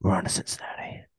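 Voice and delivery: whispered coach catchphrase; whispered quote. Retold or imitated. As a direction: whisper it reverently. Quoting famous coach line.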